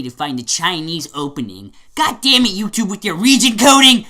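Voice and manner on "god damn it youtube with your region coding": loud, raised-pitch cursing; exasperated rant. Acted. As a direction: shout your frustration at the website. Mock rant at YouTube.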